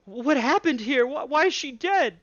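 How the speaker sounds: high, stammering giantess in shock; distraught giantess. Acted. Female giant asking the guards why her kin is dead, high and distraught. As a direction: stammer out her confusion and grief. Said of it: "and she goes".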